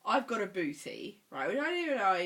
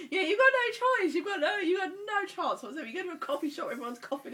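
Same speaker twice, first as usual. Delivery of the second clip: laughing exasperation; 11 semitones higher, 5 dB louder than usual. Real, not acted. Laughing about unavoidable colds.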